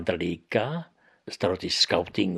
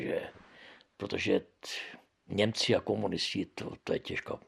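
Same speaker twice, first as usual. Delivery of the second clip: normal talking voice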